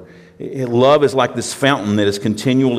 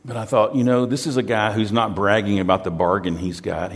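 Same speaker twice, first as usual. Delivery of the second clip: usual speaking voice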